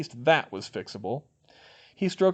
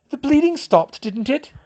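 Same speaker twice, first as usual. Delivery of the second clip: high, loud, testy retort; snapped; 13 semitones higher, 8 dB louder than usual. A narrator's reading. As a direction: snap back in self-defence. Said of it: "Hermione snapped".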